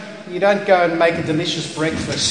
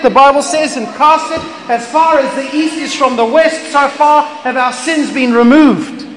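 higher, louder preaching cry; preaching at full force; 9 semitones higher, 5 dB louder than usual. Acted. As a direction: proclaim it out to the congregation.

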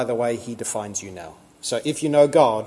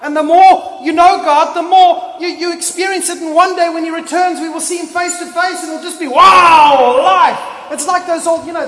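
higher, louder, rising sermon fervour; preaching at full force; 9 semitones higher, 8 dB louder than usual. Real, not acted. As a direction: build the preaching up to a shout. Preaching crescendo.

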